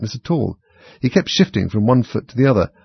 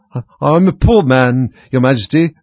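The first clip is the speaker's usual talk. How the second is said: loud, slow, trembling plea; 4 semitones higher, 7 dB louder than usual. A narrator's reading. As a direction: quaver it out in fear. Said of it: "in a trembling voice".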